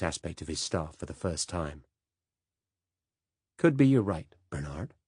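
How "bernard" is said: Bernard said ber-NARD, weight on NARD; American ber-NARD, second syllable stressed. Acted. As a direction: Bernard with a light rise on NARD. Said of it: "accent on the second syllable".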